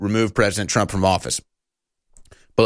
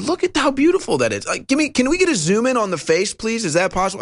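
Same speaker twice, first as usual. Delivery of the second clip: emotional gushing; 9 semitones higher, faster than usual. Real, not acted. Gushing over a baby video.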